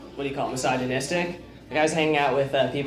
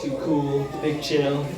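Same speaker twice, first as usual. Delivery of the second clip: Jeff Goldblum; slower than usual. Retold or imitated. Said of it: "let's do Jeff Goldblum".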